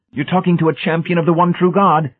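high, loud zealous proclamation; warning. A narrator's reading. Brady warning Hamilton of his standing, zealous. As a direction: declare it fervently, pitched right up. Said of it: "Brady warned".

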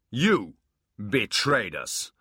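loud, slow accusation; accusing. Acted. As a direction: spit the accusation slowly. Abbott accuses Quan.

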